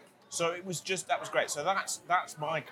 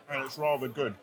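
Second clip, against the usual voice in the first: ordinary delivery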